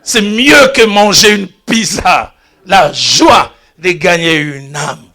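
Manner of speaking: normal talking voice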